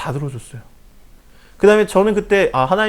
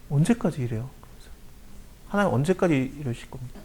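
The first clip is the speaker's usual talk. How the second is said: quiet lament; 4 semitones lower, 10 dB quieter than usual. Acted. Voicing a lament to God.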